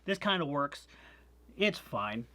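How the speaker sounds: usual speaking voice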